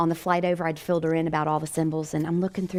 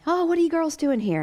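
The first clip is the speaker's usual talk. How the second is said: high, lilting imitation of a curious man; lightly acted chatty stranger; friendly pub fella; 11 semitones higher than usual. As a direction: greet them with high-pitched interest. Pub fella greets the visitors.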